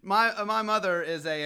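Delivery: ordinary delivery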